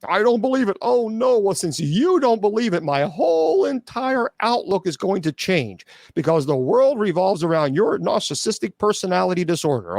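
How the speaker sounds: high, sneering parody of a skeptic; sarcastic mock. Mocking a skeptical viewer, high sarcastic sneer.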